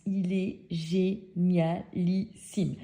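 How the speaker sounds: "génialissime" drawn out syllable by syllable; very slowly spell out each syllable of "génialissime"; emphatic praise. Real, not acted. Drawn-out emphasis praising new date. (« génialissime » détaché syllabe par syllabe)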